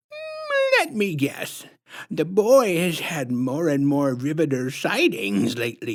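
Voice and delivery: high, slow sugary put-on voice, far above the narrator; sickly sweet menace, drawn out; syrupy sinister clown woman introducing herself; creepy clown lady. A narrator's reading.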